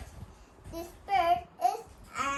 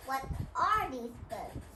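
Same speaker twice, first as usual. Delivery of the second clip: usual speaking voice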